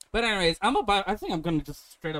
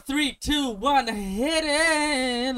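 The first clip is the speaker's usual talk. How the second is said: announcer countdown, rising hype; 10 semitones higher, 4 dB louder, much slower than usual. Acted. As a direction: count it in like a show opener. Countdown to podcast intro.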